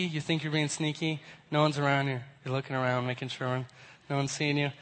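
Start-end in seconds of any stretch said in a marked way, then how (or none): none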